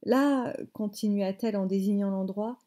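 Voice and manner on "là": higher, lightly voiced tearful grandmother; tearful indignation. A narrator's reading. Grandmother showing mother the spot, mildly tearful. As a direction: point it out with a touch of tears. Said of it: "dit-elle d'un ton larmoyant".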